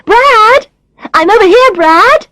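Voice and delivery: high, loud sing-out call; calling across restaurant. A narrator's reading. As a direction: call out to get his attention across the room. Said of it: "Tanya's calling to him from across the restaurant".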